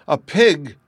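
loud, high alarmed shriek. Acted. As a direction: screech it in alarm. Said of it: "shrieked my lady".

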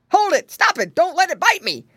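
loud, high rabbit gentleman panicking; quick yelps to be saved; hammed-up fright, shouted; alarmed cry. A narrator's reading. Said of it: "cried the old gentleman rabbit".

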